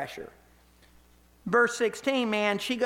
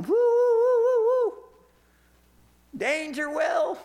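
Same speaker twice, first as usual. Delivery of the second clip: high, loud wailing alarm noise; alarm siren imitation; 13 semitones higher, 5 dB louder, slower than usual. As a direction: blare it like a warning siren. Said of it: "something inside of him should have been going".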